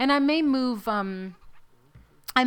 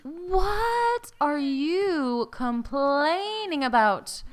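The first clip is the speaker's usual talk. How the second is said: exasperated; 7 semitones higher, 3 dB louder, much slower than usual. Real, not acted. Annoyed at whining toddler.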